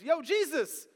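high, hammed-up casual shout; loud mock-delighted greeting; Peter. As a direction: call out with exaggerated enthusiasm, stretching the words. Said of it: "here is the way I viewed it".

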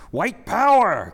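loud, high rallying shout; marchers' cry. A narrator's reading. Unamused marchers cry out at the clowns, loud drawn-out shout. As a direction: shout the slogan out, long and forceful. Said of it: "came the marchers' cry".

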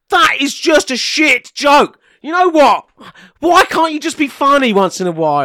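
loud, indignant outburst; mock angry shout. Co-host mocking a pun, loud indignant outburst. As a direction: bite back like he's truly insulted.

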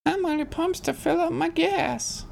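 put-on high, slow old lady voice; man doing a woman's voice. Shown as a demonstration. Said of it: "that was a beautiful old lady voice".

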